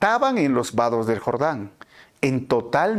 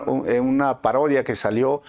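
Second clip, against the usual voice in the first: ordinary talk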